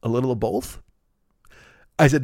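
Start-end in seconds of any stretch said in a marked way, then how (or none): 0.0-0.8 quiet, sheepish little answer; a guilty employee half admitting it. Retold or imitated. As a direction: mumble it like you got caught. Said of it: "he kinda sheepishly looked down".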